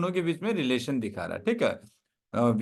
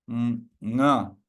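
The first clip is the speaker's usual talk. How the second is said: ordinary talk, nothing marked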